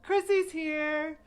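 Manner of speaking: loud, high, drawn-out welcome; excited announcement. Real, not acted. Host spotting Chrissy join the stream, loud excited call. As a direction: call out her arrival, stretched and high.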